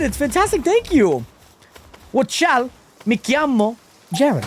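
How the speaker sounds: slow, careful try at an Italian accent; attempted Italian accent. Shown as a demonstration. Streamer introducing himself in Italian, attempted Italian accent. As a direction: say it slowly in an imperfect Italian accent. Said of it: "Might not say it with an Italian accent properly".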